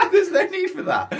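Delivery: squeaky laugh through the words; high laughing protest. Real, not acted. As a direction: giggle out a mock protest. Mock-offended, laughing high.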